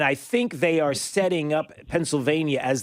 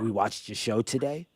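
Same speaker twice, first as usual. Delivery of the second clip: lower, softer imitation of an upset mother; mother's voice; 4 semitones lower, 5 dB quieter than usual. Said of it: "She said".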